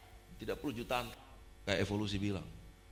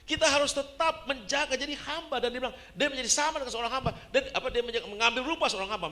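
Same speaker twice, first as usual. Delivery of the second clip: high, loud sermon delivery; fiery preaching; 12 semitones higher, 9 dB louder than usual. Acted. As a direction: drive it home to the whole congregation. Preaches at full force.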